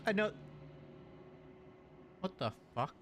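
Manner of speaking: usual speaking voice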